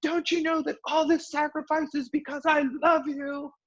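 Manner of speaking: very high, strained mock parent at wit's end; exasperated parent yell. Parent yelling at their kids, high strained mock yell. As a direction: shriek it like a frazzled parent. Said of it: "when a parent yells at their kid".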